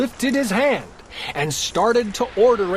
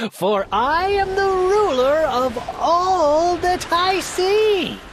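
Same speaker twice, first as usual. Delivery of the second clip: high, proud king's voice; a smug turtle king gloating; boastful turtle king; 5 semitones higher than usual. Acted. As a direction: crow about your throne from on high. Yertle boasting.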